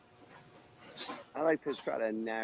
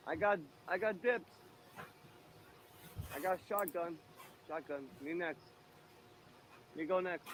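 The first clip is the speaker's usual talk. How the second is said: mock eager dibs; 5 semitones higher, 6 dB quieter than usual. Calling dibs on a massage.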